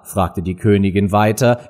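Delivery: ordinary delivery, nothing marked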